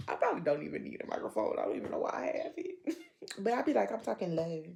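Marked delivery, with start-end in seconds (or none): 0.1-3.0 whisper. Real, not acted. Aside about talking low.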